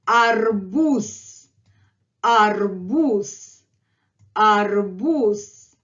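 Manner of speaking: slow "arbuz" with a drawn-out stressed u and a final s; slow model pronunciation. Shown as a demonstration. Teacher models the word for learners, slow and drawn-out. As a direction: stretch "arbuz", ending on a voiceless hiss. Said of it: "I'm going to say arbuz".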